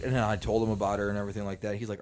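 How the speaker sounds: ordinary talk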